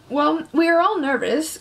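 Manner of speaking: plain reading voice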